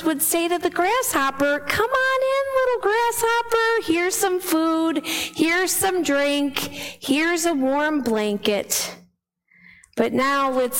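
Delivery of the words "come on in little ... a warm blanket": high, gentle and slow welcoming voice; kind welcome. Pretend. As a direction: coax the grasshopper in kindly. Ants welcome the grasshopper kindly.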